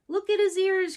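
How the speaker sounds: high, loud mocking boy; a jeering boy pointing out the ears; noisy boy crying out. A narrator's reading. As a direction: call out like a jeering kid. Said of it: "cried one noisy boy".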